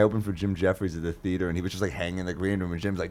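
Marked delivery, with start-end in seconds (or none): none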